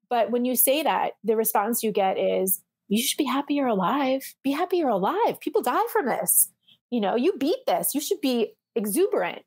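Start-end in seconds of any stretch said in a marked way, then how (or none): 2.9-9.4 swooping singsong as you mock well-meaning advice; insistent well-meaning advice. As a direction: mimic chirpy, lilting reproach, pitched up. Mimics well-meaning people scolding survivor.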